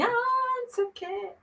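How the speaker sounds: high, soft affected sigh of a showboating cantor; mock showy singer. Mocking a showy psalm singer to seminarians, high and airy. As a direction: lampoon the singer who closes eyes and raises hands. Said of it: "muito do que ficar assim".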